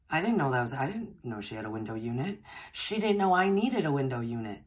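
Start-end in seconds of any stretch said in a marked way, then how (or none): 2.7-4.6 louder and higher, marvelling; excited emphasis. Real, not acted. Telling viewers how neither knew, excited and louder. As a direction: punch it up, amazed at the timing. Stressing the coincidence.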